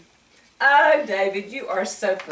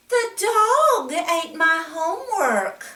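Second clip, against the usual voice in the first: put-on child's excuse; 8 semitones higher than usual. David's excuse voiced.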